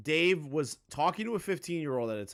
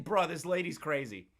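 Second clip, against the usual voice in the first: ordinary talk, nothing marked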